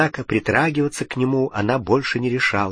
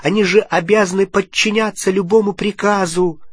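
urgent plea; 8 semitones higher than usual. A narrator's reading. Hermione pleading with Harry.

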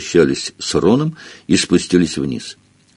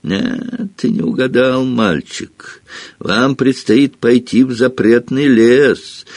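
mocking; 5 semitones higher than usual. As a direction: sneer it at the boy. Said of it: "Филч издевательски произнёс".

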